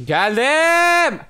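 high drawn-out triumphant cry of arrival; excited shout. Real, not acted. Streamer announcing his gank to chat, high drawn shout. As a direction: belt it out as you jump in.

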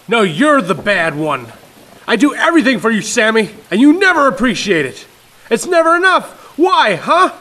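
loud, rising near-breaking outburst; father breaking down at his son; wavering, emotional. Acted. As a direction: let the voice climb and crack. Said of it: "his voice wavering, changing pitch".

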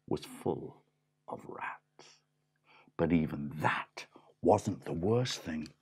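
hushed, spooky reveal; whisper. A narrator's reading. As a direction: lean in and whisper it.

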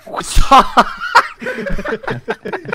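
high, helpless laughter; laughing fit. Real, not acted. Reacting to the scene.

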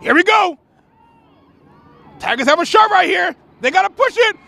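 high-pitched, urgent play-by-play; excited build-up call. Real, not acted. Commentator as Tigers line up a shot, excited.

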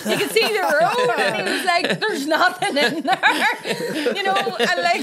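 high, loud, laughing through the words. Real, not acted. Telling friend dad's bottomless-boot story, cracking up. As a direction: barely get it out for laughing.

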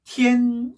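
"티엔" drawn out on one level pitch; first tone. Shown as a demonstration. Teacher answering how to read it, "티엔" level tone. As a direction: hold "티엔" steady without dipping. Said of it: "천을 어떻게 읽죠".

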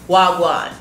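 usual speaking voice

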